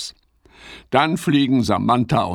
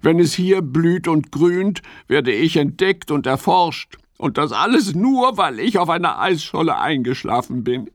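higher, earnest little frog voice; Ole the snow frog; 9 semitones higher than usual. Acted. Ole explains his secret to Fips, earnest.

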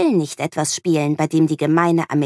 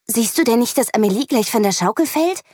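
put-on higher-pitched teacher scolding a child; teacher scolding the girl who pushed the swing, raised pitch; 6 semitones higher than usual. A narrator's reading. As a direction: tell her off, pitch up. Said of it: "schimpft sie".

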